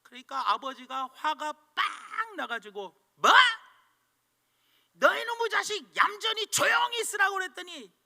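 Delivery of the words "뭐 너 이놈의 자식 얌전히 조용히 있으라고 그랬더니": put-on high, loud angry shout at the boy; furious father yelling. Father scolds his son for drawing on the wall, shouting. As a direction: blow up at your son. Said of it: "소리를 빠락 치는 거예요".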